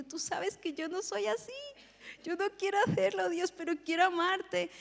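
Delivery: high-pitched, torn pleading; re-enacted anguished prayer; retold tearful plea. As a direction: plead upward as if near tears. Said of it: "me puse a llorar".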